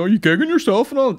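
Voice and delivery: high, loud imitation of a chatty comic; Blind Boy. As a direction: pipe up in a put-on high voice. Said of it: "Sounds exactly like Blind Boy".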